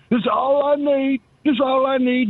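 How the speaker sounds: high-pitched mock movie quote; hammed-up high comic impression; Steve Martin in The Jerk. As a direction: imitate the comic character, pitched well up. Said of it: "kinda like Steve Martin in The Jerk".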